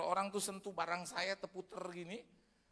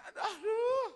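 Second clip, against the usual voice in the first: high, loud comic wail; hammed-up crying aduh; mock anguish; 10 dB louder, slower than usual. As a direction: wail over the ruined table. Furniture stained, comic anguish.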